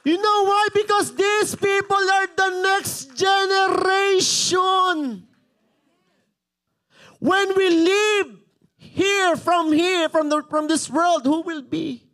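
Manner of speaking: high, loud preacher's cry; impassioned pulpit shout; full-force preaching. Real, not acted. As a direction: proclaim it to the whole church, stretching key words.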